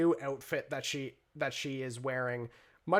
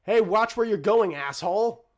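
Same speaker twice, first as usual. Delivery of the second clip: loud, raised-pitch indignant shout; calling out; 6 semitones higher, 10 dB louder than usual. Acted. Renee yells at the teenager who shoved past, calling out. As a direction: yell it after someone who shoved past. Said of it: "Renee immediately calls out".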